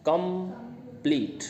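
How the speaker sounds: "Complete" split slowly into com, then pleet; slow, soft "complete" with a gap between syllables; syllable break. Shown as a demonstration. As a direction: say "complete" in two parts, com – pause – pleet. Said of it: "इसमें दो sound है".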